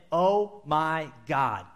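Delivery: slow, drawn-out oh my God; lazy casual oh my God; offhand exclamation. Retold or imitated. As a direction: toss it off like everyday slang. Scribes quote a casual exclamation.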